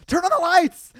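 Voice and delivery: high, loud panicked demand; retold yell. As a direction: re-enact calling out in the dark, scared. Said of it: "I literally yell".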